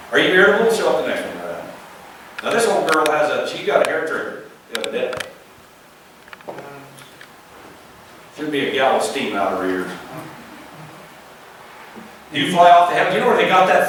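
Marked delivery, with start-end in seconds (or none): 3.5-9.9 fast, soft, low joking aside; quiet aside. Real, not acted. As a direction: murmur it fast as a side joke.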